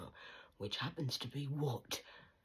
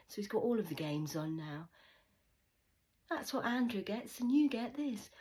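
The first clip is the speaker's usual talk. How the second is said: higher, louder sneering mum voice; mocking mum; 7 semitones higher, 6 dB louder than usual. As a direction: mimic a smug mother rubbing it in. Mocking mum about the holiday.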